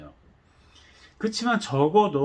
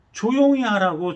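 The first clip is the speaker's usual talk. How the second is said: sharp raised hush order; scolding; 6 semitones higher, 5 dB louder than usual. Retold or imitated. Crowd hushing the beggar, raised scolding. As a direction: bark the hush at him. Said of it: "아예 꾸짖었어요".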